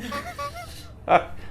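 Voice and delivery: high, soft laugh; real amused laughter. Real, not acted. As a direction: laugh at your own punchline. Laughs at own punchline.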